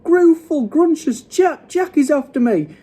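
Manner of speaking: high, loud, frantic ranting; bellowing. A narrator's reading. Giant attacked by Jack in a nightmare, bellowing. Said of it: "bellowed the flesh lump eater".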